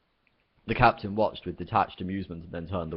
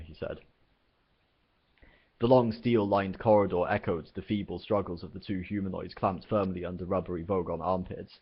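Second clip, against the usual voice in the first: ordinary delivery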